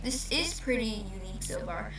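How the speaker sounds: normal talking voice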